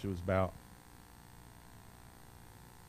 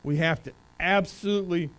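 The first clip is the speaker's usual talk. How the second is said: louder, higher, building emphasis; preaching build-up; 9 semitones higher, 9 dB louder than usual. Real, not acted. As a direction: drive the point home to the congregation. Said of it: "building preaching force".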